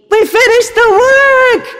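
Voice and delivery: overjoyed. Shown as a demonstration. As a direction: cry it out with delight. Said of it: "according to the speaker's tone of voice".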